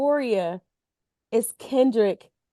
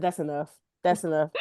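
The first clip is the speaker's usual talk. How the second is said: low stern mutter; 7 semitones lower, 5 dB quieter, faster than usual. Retold or imitated. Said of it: "I said".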